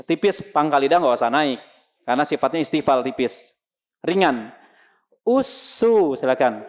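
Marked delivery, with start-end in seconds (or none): 5.3-6.1 Usu with a light, thin s. Shown as a demonstration. Teacher demonstrates thin sin with dhammah. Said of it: "tipis ya".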